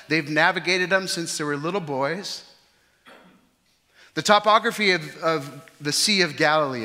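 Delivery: loud, high preaching; preaching at full force. Acted. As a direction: preach it out to the hall.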